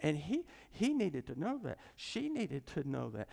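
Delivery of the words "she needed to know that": ordinary delivery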